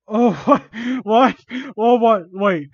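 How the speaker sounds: loud, high exclamations of disbelief; baffled outburst. Real, not acted. Reader reacts to baffling text, loudly surprised. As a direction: burst out in confusion.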